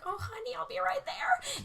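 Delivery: high, airy motherly reassurance; imitated comforting mom. Mom to crying daughter on phone, comforting. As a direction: coo it like a worried mother.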